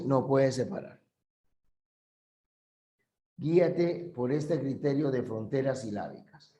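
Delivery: normal talking voice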